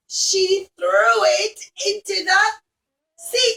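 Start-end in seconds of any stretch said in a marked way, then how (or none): none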